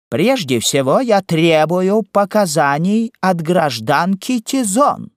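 high, slow, forceful demand; roaring. A narrator's reading. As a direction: bellow it out, drawing the words out. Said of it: "ревел сапожник". (рёв, протяжно и требовательно)

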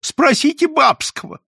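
high, loud appeal to a witness; voiced pleading character; defensive protest. A narrator's reading. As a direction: defend yourself with a high cry. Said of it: "защищался Филюрин".

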